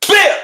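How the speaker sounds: sharp, high, loud burst; one sudden high pop of impact; imitated hit noise. Storyteller sounding out the blow she landed, sudden loud imitation.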